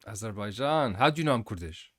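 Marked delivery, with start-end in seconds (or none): none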